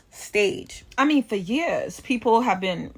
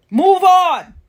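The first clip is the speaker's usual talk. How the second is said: forceful command; 7 semitones higher, 7 dB louder than usual. Real, not acted. Preaching insistence.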